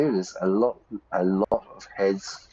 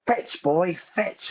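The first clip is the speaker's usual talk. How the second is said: high call to a dog, fading to a breathy final fetch; 11 semitones higher, much slower than usual. Retold or imitated. Owner calling his dog to fetch in a high call. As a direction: call it out to the dog across the park. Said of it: "I say".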